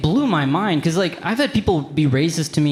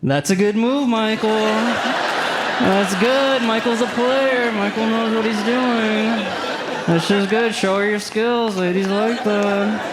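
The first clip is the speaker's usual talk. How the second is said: high, slow approving coach voice; mock impressed viewer; 9 semitones higher than usual. Comic cheering Michael on from his couch, mock impressed. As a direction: nod along, mock impressed.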